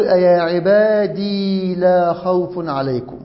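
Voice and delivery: عبادي drawn out slowly on a long final ee; lengthened final ya. Shown as a demonstration. Teacher showing the variant reading, long held ee in عبادي. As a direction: hold the ee at the end of عبادي. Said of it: "بإثبات الياء ساكنة".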